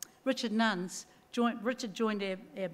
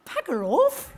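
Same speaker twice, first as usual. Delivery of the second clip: higher, louder mock retort; ex-husband's retort; 6 semitones higher, 5 dB louder, slower than usual. Ex-husband refusing to stay her manager, mock-rude retort. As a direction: toss it off playfully rude. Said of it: "And he said".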